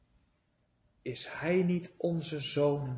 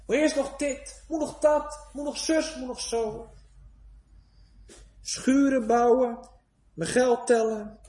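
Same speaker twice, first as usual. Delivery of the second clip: mocking busy excuses; 7 semitones higher, 3 dB louder than usual. Mimicking busy worldly excuses.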